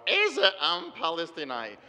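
put-on Hebrew phrase slowly, in a flat Midwestern American accent; American Milwaukee accent. Golda Meir dismissing the Palestinians, in a slow Milwaukee American accent. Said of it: "or in her little American Milwaukee accent".

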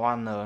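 "one, no" with the T of two dropped into a long N; nuốt âm t. Shown as a demonstration. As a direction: run "one" into "no", no T at all. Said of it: "Đọc này đấy". (đọc "one, no" nối âm n, bỏ t)

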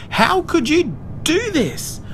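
high, slow outraged wail; shrill indignant shouting; furious shout. A narrator's reading. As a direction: yell the accusation, drawing it out. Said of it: "Willa shouted furiously".

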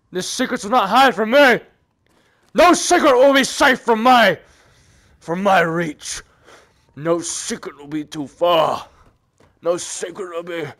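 hammed-up grand hero voice; mock-dramatic vow. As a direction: declaim it grandly, stretching out the words. Mock-dramatic vow about finding secrets.

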